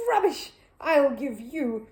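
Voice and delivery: high, loud, slow indignant splutter; spluttering rage. Acted. Mister Curry spluttering before sitting on the egg. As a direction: sputter with rage.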